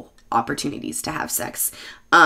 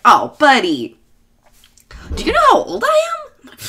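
mock incredulous; 13 semitones higher, 6 dB louder than usual. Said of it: "And he was like".

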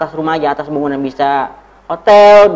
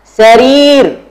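high, loud, drawn-out model word; drill call-out; 7 semitones higher, 7 dB louder than usual. Shown as a demonstration. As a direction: call it out for the class to repeat. Teacher models word for repeat drill.

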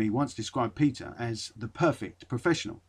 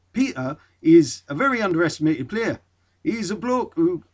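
put-on a first, half-hearted try at a Geordie accent, higher; 4 semitones higher, 5 dB louder than usual. Reading a teammate's quote, shaky first Geordie accent attempt. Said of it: "I can't do a Geordie accent".

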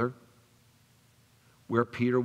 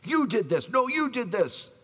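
mock quarrel; 11 semitones higher, 4 dB louder than usual. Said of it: "Just acting crazy".

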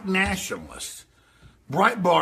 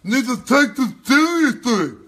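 mock voice; 8 semitones higher, 7 dB louder than usual. Host mimicking the guest's call on companies, high and loud. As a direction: ham it up as a clueless elitist.

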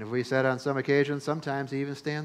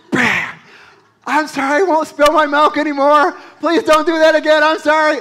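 much higher, louder mock wailing child; hammed-up fake wailing; 13 semitones higher, 14 dB louder than usual. Boy faking a belt beating on his bed, hammed-up wailing. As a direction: overplay the begging kid so mom hears. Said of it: "it'd be like".

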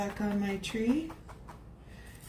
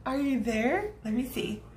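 ordinary talk, nothing marked

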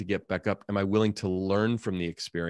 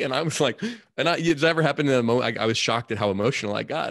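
ordinary talk

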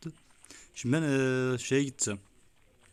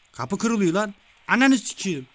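startled yell; 8 semitones higher, 9 dB louder, slower than usual. Real, not acted. Door breaking in the game.

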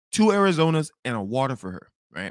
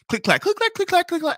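high-pitched mimicked typing; rapid, high imitation of noisy keys; louder keyboard clacking; 13 semitones higher than usual. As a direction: clack away pointedly in a high voice. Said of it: "I click clack even h-, louder".

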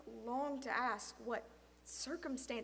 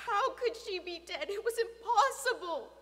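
high, loud, agitated; distraught disbelief; 9 semitones higher, 13 dB louder than usual. A narrator's reading. Boy reeling from friend's death, distraught. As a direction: race through it in disbelief. Boy's shocked thoughts at death.

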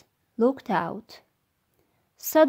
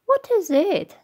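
queen voice; 9 semitones higher, 4 dB louder than usual. Acted. Queen asks in the morning.

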